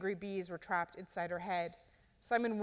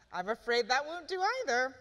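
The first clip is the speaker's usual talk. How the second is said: cruel delight; 5 semitones higher, 3 dB louder than usual. A narrator's reading.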